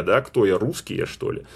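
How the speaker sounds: ordinary talk, nothing marked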